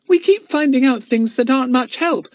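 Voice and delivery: put-on high-pitched little child; high, plaintive child character; young girl lamenting. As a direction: complain earnestly in a small girl's voice. Plays young Betts complaining.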